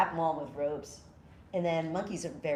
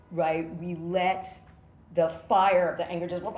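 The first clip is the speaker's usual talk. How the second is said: normal talking voice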